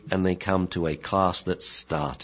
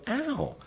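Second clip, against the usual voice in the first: sudden much higher yelp, softer; mock ow; 7 dB quieter than usual. Shown as a demonstration. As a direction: yelp like you're hurt. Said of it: "we go".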